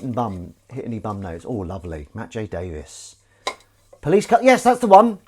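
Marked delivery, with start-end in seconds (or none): 4.0-5.2 loud, high burst of delight; sudden high, loud eureka; excited recognition. Real, not acted. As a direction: exclaim it as if it just clicked. Recognises the programme title mid-sentence.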